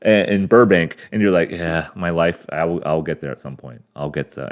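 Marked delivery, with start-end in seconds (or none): 1.5-4.5 resigned mumble. Retold or imitated. Imagined resigned adjunct self-talk.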